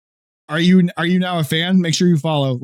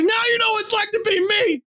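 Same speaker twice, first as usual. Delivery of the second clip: high, laughing mock shout; mock gloating. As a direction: gloat at her in a high, half-laughing yell. Teasing wife, retold quote.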